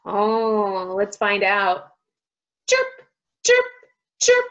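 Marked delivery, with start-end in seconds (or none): 2.7-4.5 tiny, short bird chirps; quick separate peeps; squeaking chicks. A narrator's reading. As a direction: squeak each word like a baby bird. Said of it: "squeaked the chicks".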